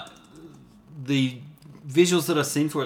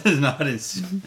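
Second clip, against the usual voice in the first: higher, louder, slow words through laughter; laughing echo; 5 semitones higher, 5 dB louder, slower than usual. Real, not acted. Co-host repeats the joke back, laughing. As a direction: repeat it while cracking up. Amused echo of co-host's line.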